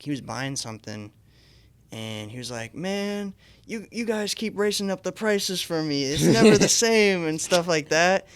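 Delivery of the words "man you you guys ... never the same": mildly acted, higher-pitched complaint; a hint of an aggrieved customer; griping customer. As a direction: lightly voice a customer griping at the cashier. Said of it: "like, yelling at the cashier".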